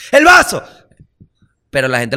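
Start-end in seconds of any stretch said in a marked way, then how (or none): 0.1-0.6 high, loud shout about the glass; warning a friend about the glass; warning yell. Retold or imitated. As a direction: yell to stop the glass falling. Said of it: "que le avise".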